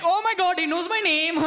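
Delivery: giddy high-pitched squeal of delight; starstruck squeal. Acted. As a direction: gush like a thrilled nobody noticed by the popular kid. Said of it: "Sri Lanka's like".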